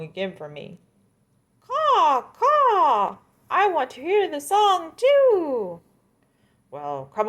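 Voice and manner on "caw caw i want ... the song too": very high, loud cawing; shrill mocking crow. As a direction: caw it out like a crow.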